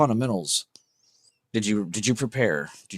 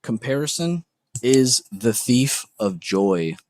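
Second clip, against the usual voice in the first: slow saying; slower than usual. Real, not acted. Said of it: "quoting a saying slowly".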